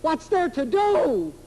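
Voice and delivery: slow, high mocking echo of the question; incredulous repeat. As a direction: drag it out in disbelief. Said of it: "I swear it".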